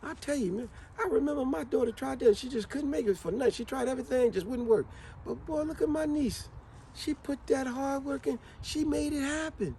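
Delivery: a light imitation of a proud aunt. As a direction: coo over the niece's success. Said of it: "that's how Aunt Marie would talk".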